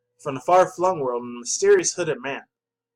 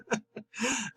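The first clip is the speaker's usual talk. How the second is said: laugh; 8 semitones higher, 8 dB quieter than usual. Real, not acted. Laughing at own accent.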